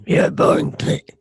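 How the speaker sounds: raspy demon whisper. Pretend. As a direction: hiss it like a demon, unvoiced. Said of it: "my inner demon voice".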